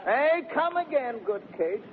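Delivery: high coaxing call; calling her back. Acted. Calls her back as she leaves.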